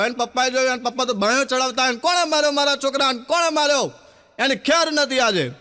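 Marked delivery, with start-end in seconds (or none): 1.9-3.9 angry father. Retold or imitated. Father sleeves rolled, confronting.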